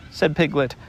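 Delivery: plain reading voice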